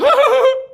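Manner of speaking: sudden high cackle at his own joke; high burst of laughter. Real, not acted. Laughing at his own indoor-smoking joke, a high cackle.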